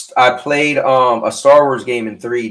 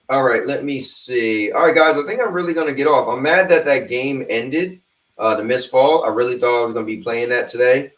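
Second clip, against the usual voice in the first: ordinary delivery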